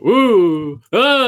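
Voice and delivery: high, loud startled yelp; startled ooh ah. Real, not acted. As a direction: jump at the creepers with a yelp. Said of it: "you just heard me go".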